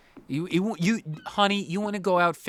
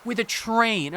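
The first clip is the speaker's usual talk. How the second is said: exasperated disbelief; 10 semitones higher, 3 dB louder than usual. Retold or imitated. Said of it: "I'm like".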